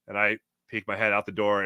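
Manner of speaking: normal talking voice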